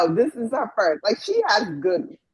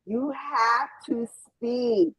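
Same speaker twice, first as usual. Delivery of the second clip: slow, spaced-out words; deliberate, word-by-word instruction; slow insistence; slower than usual. Real, not acted. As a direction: spell it out slowly for them. Insists silent viewers must speak up.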